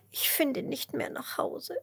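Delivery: tiny high tearful worm voice; gespieltes Schluchzen. A narrator's reading. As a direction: play a little worm sobbing, high and whispery. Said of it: "schluchzt der Regenwurm". (hoch und weinerlich schluchzend)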